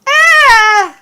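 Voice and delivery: a sudden high yelp of fright; startled yelp. Acted. A caught player character yelps in shock, very high. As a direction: jump and cry out, startled. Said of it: "And you go".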